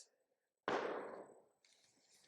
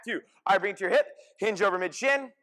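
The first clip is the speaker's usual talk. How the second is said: ordinary delivery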